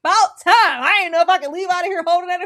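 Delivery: high, loud, hurried mock relief; reenacting gleeful relief, rattled off; acted relief. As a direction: burst out as if finally unburdened. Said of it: "the way Wrigley was like".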